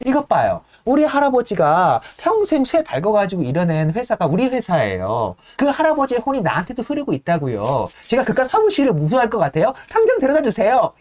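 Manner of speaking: offended, then insisting harder and harder; indignant heiress. Retold or imitated. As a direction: heiress scolding the servant for treating her like a child, rising to a demand. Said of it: "이렇게 얘기하는 거예요".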